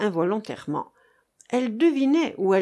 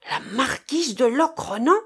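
astonished echo, pitched up high; startled exclamation; 6 semitones higher, 4 dB louder than usual. A narrator's reading. Sailor leaping up on hearing the captive's name, startled exclamation. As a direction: exclaim the title in disbelief, rising at the end. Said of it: "s'écria Marcof en bondissant".

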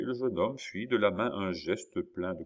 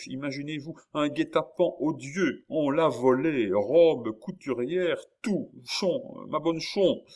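higher, agitated, breaking into fragments; distraught outpouring; 7 semitones higher, 5 dB louder than usual. A narrator's reading. Jean telling of the stolen dress, distraught. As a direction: pour out the outrage piece by piece.

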